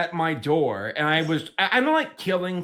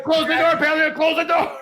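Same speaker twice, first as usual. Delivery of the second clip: high, loud mock panic, half laughing; retold frantic plea to shut out danger; panicked yell; 11 semitones higher, 4 dB louder than usual. Retelling panic at charging spider.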